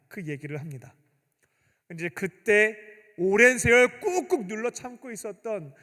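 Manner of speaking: ordinary delivery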